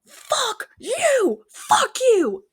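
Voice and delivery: high mock yell from a passing car; hammed-up high screech of an angry driver; retold yell. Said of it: "this lady yelled".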